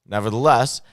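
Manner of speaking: loud, emphatic King James declamation; dramatic scripture reading. Acted. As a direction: declaim the verses with stretched key words.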